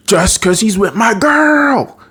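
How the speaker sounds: slow, high-pitched cartoon whine, drawn out; hammed-up possessive cry, stretching the words; jealous outburst. Acted jealous outburst over girlfriend.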